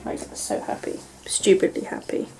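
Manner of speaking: soft whispered happiness; whispered giddy happiness; whispered joy. Real, not acted. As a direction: hush it out, giddy.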